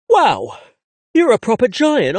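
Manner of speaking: high, loud amazed exclamation; awed wow. A narrator's reading. Narrator meets the giant dad, amazed. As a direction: gasp at how huge he is. Stunned meeting his dad.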